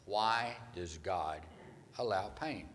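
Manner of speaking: why does God drawn out slowly; slow, weighty question. Shown as a demonstration. Preacher asking the congregation, slow and weighty. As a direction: let why does God hang in the air. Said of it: "as we try to answer the question".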